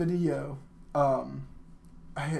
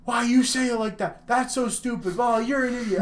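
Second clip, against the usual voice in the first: high, louder mock indignation; mock outrage; 8 semitones higher, 4 dB louder than usual. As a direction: berate them like an offended word snob. Acts out people attacking her pronunciation.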